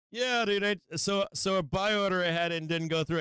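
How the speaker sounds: usual speaking voice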